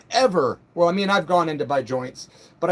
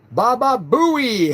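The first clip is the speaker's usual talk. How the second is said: slow, stretched, louder Baba Booey call; catchphrase shout; 4 semitones higher, 5 dB louder, much slower than usual. Real, not acted. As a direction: bellow it like the radio gag. Shouting the Howard Stern catchphrase.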